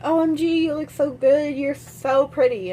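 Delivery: gush, stretching the praise at the end; imitated gushing mom. His mother gushing, retold.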